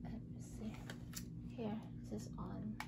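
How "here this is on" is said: ordinary talk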